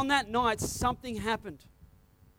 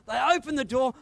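normal talking voice

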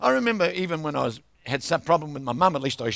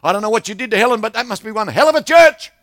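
louder, higher, amazed; barber's loud remark; 5 semitones higher, 10 dB louder, faster than usual. Retold or imitated. Barber tells the preacher about Helen, loud. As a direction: boom it out in disbelief. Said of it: "he says".

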